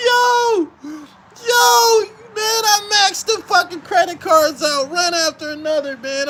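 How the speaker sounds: high, loud, cracking up; laughing brag. Real, not acted. Bragging about maxing cards.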